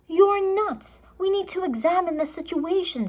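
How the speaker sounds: put-on boy reasoning with his sister; Jack arguing. A narrator's reading. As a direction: argue her down.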